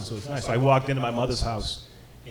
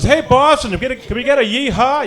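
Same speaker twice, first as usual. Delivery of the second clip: high, loud hype-man call; rally the stands like an arena announcer; PA announcer imitation; 10 semitones higher, 7 dB louder than usual. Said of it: "the P A announcer goes".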